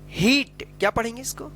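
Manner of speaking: ordinary delivery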